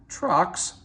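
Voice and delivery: a cross big engine's outraged question; crossly. A narrator's reading. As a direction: spit it back, offended. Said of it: "said Gordon crossly".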